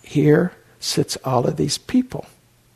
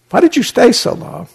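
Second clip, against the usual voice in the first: high, louder imitation of a puzzled teacher; mock reproachful question; 9 semitones higher, 7 dB louder than usual. Teacher greeting student leaving the cave, imitated high reproachful question. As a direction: demand an explanation, pitched high and loud.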